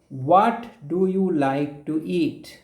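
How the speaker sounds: ordinary talk, nothing marked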